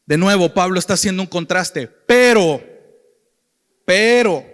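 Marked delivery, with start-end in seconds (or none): 1.9-2.6 loud, forceful "Pero"; emphatic contrast. Shown as a demonstration. Recalca el contraste de Pablo. 3.8-4.5 "pero" repeated loud, standing alone; emphatic contrast. Shown as a demonstration. Repite el contraste de Pablo.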